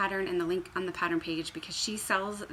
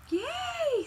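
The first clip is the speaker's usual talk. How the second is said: very high yay; cheer. Real, not acted. Crafter cheering her own purse, very high.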